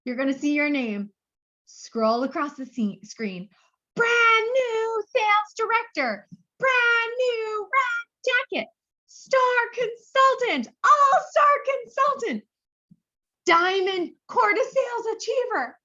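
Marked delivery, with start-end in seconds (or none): 3.9-15.8 high, slow, ringing award call; grand announcer. Pretend. To consultants, calling out award titles like a gala announcer. Imagining names scrolling on screen.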